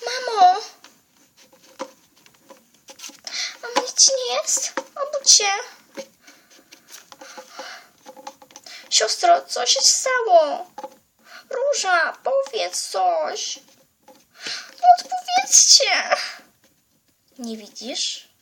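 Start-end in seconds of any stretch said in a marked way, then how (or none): 0.1-13.6 put-on girl screaming over her dead family; panicked crying out. 14.8-16.2 hysterical plea. Acted. Girl begs dead family.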